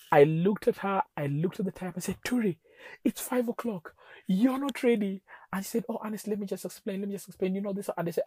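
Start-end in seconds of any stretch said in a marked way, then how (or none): 2.2-5.2 high, loud retold outburst; exasperated, pitched right up; flipped out. Husband scolding wife for not being ready, flipped out. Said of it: "I flipped". 5.9-7.9 high, hurried retold plea; pleading. Wife cut off by angry husband, pleading to explain.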